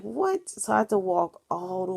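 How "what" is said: incredulous high-pitched "What?" shooting way up; shocked what. Retold or imitated. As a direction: gasp it out in disbelief. Hears he wants tough love.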